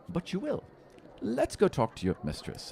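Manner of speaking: a sly little seeker teasing his find; halfling seeker. Acted. Halfling leads them home.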